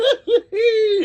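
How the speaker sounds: sudden high laugh breaking out; burst of laughter. Real, not acted. Host cracking up over the coach's fake accent, loud laugh. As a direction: laugh out loud at your own joke. Laughing at his own Kelly jab.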